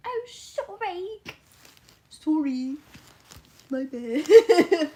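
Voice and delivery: put-on high, teasing Canadian accent; hammed-up Canadian apology; mock Canadian accent. Streamer teasing a Canadian chatter, mock Canadian accent. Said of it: "Sheefy's Canadian".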